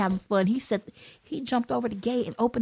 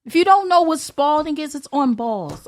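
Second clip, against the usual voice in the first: usual speaking voice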